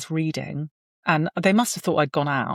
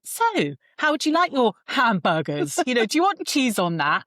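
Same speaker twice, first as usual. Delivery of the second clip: forced breeziness; 6 semitones higher than usual. Retold or imitated. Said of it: "a bit of sort of weirdness coming out of me".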